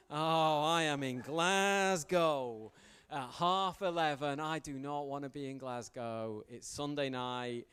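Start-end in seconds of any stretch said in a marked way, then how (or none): 0.1-6.4 loud, slow, dismayed cry; exasperated outburst. Retold or imitated. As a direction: groan it out loud and slowly, stranded and fed up. Stranded late in Glasgow, exasperated.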